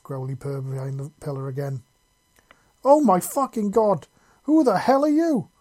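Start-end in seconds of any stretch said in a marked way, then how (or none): 2.8-5.5 high, loud panicked shriek; panicked yell. A narrator's reading. Raider spotting the hidden claw, panicked high yell. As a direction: scream it in sheer panic. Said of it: "yelled in a panicked voice".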